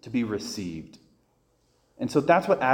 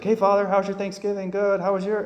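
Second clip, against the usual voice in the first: high-pitched imitation of calling across; mock holler in a raised pitch; retold calling across; 8 semitones higher than usual. Lady greeting Father from across the way, mock yelling. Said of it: "We're yelling".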